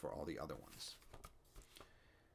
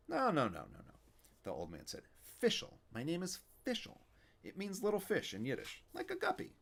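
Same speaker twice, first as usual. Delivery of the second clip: put-on a kvetching old man, raised up; Yiddish old man; 11 semitones higher than usual. Fischel voice continues.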